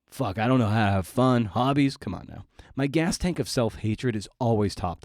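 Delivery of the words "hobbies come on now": ordinary talk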